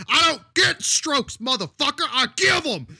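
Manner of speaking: full-volume, high-pitched sideline scream; Jim Brown. Retold or imitated. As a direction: imitate a raging coach's screamed comeback. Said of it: "he screams at the defense".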